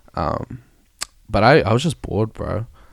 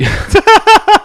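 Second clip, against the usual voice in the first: bursting laugh; 12 dB louder than usual. Real, not acted. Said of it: "It's so much harder when it's monotone".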